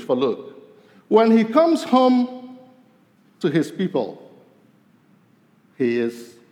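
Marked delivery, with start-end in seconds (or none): none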